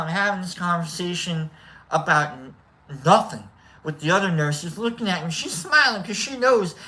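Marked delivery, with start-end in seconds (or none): none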